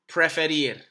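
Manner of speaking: Preferir said crisply for students to echo; model word to repeat. Shown as a demonstration. Teacher to students: models Preferir for them to repeat. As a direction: give Preferir as a clear model. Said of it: "I want you to repeat 'em".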